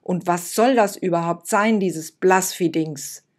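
angry. Acted. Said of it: "Da wurde Lucia aber auch ganz schön wütend".

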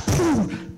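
high, yelled comic-book poof; a yelled fight noise; shouted sound effect. Acted.

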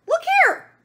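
loud, very high call. A narrator's reading. The cow calls the animals to look, calling out loudly. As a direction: call everyone over excitedly.

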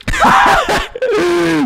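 high-pitched burst of laughter; screechy game-chase cackle; shrieking laugh. Real, not acted. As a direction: crack up with a shriek.

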